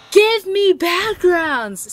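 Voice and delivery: hammed-up fan shout; mock shout. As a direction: yell it like a greedy fan. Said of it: "people are like".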